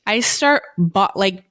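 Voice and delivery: usual speaking voice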